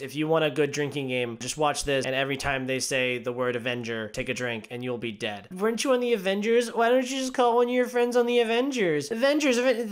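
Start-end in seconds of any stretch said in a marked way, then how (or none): 5.5-9.9 higher, fast, sing-song mockery of the dialogue; mock-quote the film, pitched way up; mocking movie line. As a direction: parody the script with a sneer.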